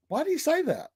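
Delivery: high, soft puzzled thought; retold bafflement. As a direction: wonder it quietly to yourself, pitched right up.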